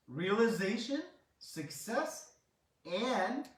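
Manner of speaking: emphatic retold decree, rising on each listed item; divine proclamation. Krishna accepts the poor month as his own, emphatic proclamation. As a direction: proclaim each gift grandly, pitch climbing.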